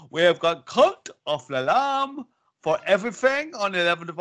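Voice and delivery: Kim Woods. Acted. Co-host imitating an absent colleague for the new releases, mock accented impression. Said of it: "Kim Woods version".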